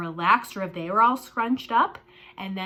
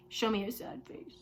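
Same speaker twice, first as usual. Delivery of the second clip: much softer, drooping sad voice; 9 dB quieter than usual. Shown as a demonstration. Teacher modelling a sad face for the class. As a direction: let the words sink down sadly.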